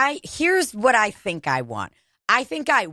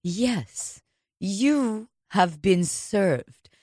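mock official; 3 dB quieter, much slower than usual. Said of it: "they're like".

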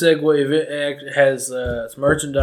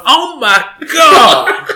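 high, loud, slow gasp of a shocked mother; shocked mom; 11 semitones higher, 12 dB louder, much slower than usual. Retold or imitated. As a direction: imitate mom's stunned cry. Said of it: "Mom saw that and she went".

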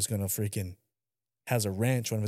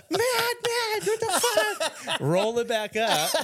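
high, slow, laughing shout; wife getting sprayed, retold high with laughter; laughing mock wife; 4 dB louder, slower than usual. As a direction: quote her through giggles. Wife yelling in car wash.

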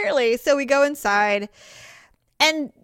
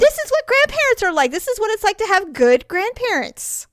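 higher, louder gushing delight; much higher excited exclamation; delighted realization; 9 semitones higher, 3 dB louder than usual. Retold or imitated. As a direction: marvel out loud with joy. Delighted thought about grandparents.

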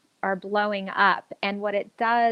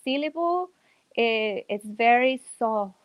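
Mexican accent. Shown as a demonstration. Actress demos her Mexican accent for the host. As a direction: ease into a relaxed Mexican accent. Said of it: "with a Mexican accent, everything's really relaxed".

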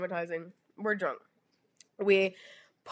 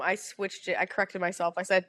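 ordinary talk

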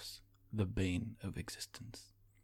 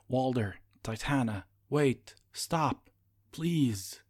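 louder, higher desperate calls; desperate shouts; 4 semitones higher, 8 dB louder, slower than usual. A narrator's reading. Magius begging Walder and Titana to wait, desperate shouting. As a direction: yell after them, begging them to stop. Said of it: "voice desperate".

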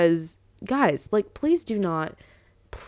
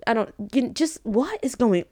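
ordinary talk

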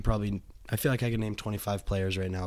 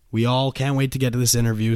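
ordinary talk, nothing marked

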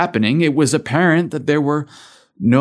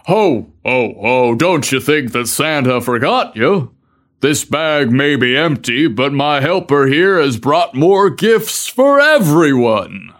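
loud, jolly Santa ho ho ho; hearty Santa bellow; 3 dB louder than usual. A narrator's reading. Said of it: "Santa quickly bellowed a loud and hearty".

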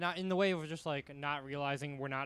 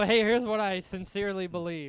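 loud, higher mock declaration; mock sincerity; 4 semitones higher, 8 dB louder than usual. Comic quoting another performer's speech, mock sincere. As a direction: proclaim it with put-on earnestness. Mocking someone's earnest stage declaration.

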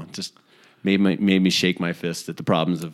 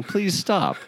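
pleading, pitched way up; high mock plea; 10 semitones higher than usual. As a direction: beg them in a high voice.

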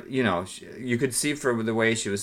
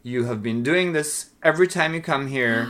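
raised, high-pitched demand for respect; a shop girl pushing back, higher and firmer; firm protest; 9 semitones higher, 4 dB louder than usual. Retold or imitated. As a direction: stand up for yourself, voice pitched up. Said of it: "she was like".

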